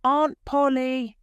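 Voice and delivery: joyful loud call across the room; glad shout. A narrator's reading. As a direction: call out the name loud and high. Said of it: "Then he shouts".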